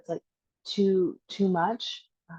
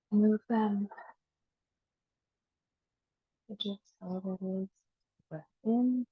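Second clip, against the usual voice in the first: calm guiding voice with long pauses for breath; guided body scan meditation. Acted. Teacher bringing awareness to the toes and heels, guided meditation.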